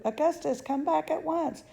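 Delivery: high, loud calling after him; mother calling after son up the pipe, high and loud; frantic calling. Acted. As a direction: call him back in panic.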